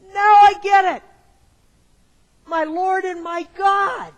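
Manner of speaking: high, loud, bursting shout of sudden understanding; loud, high thrill of discovery; excited realization. Retold or imitated. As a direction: cry it out like it finally clicked. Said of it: "with a certain excitement and pitch in his voice".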